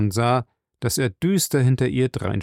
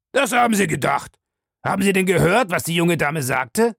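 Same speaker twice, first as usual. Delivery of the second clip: raised, sharp retort; irritated challenge; 8 semitones higher, 4 dB louder than usual. Acted. Master questions butler about the lady, irritated and sharp. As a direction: fire the questions back crossly.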